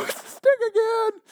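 high, slow, squeaky grumble; reluctant Moses. Retold or imitated. Moses grabbing the snake stick, high slow whine. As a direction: mutter it like a scared kid.

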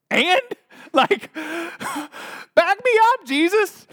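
high, loud comic plea; alarmed Peter. Acted. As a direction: squeal it in mock panic. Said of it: "I imagine Peter going".

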